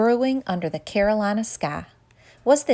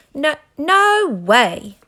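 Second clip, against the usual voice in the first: angry disbelief; 6 semitones higher, 7 dB louder, much slower than usual. A narrator's reading. Cocky stands up angry.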